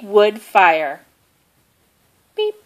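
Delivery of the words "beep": ordinary delivery, nothing marked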